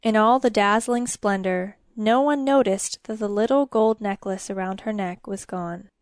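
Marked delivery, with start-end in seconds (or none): none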